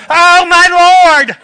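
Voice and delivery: high, loud, drawn-out mock shock; scandalized church gasp. Churchgoers hearing sex talk in church, mock scandalized gasp. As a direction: play the prim churchgoer clutching pearls. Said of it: "in church and we go".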